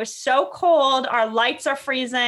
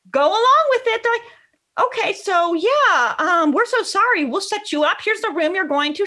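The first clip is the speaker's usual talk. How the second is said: accommodating hotel staff; 8 semitones higher than usual. Retold or imitated. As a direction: sound eager to please the guests. Hotel staff voiced, playing along.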